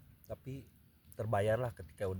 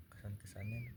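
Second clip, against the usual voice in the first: whispering; 9 dB quieter than usual. Real, not acted. Whispered aside while eating.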